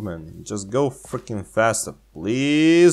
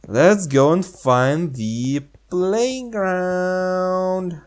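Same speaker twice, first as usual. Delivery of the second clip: drawn-out playful character line; slow character voice; 8 semitones higher, much slower than usual. Pretend. Playing the game character, slow and drawn out. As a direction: stretch it slowly like a cartoon kid.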